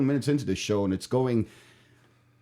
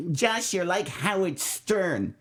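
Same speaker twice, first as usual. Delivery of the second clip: mock mom; 8 semitones higher, much slower than usual. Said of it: "they're not gonna be happy".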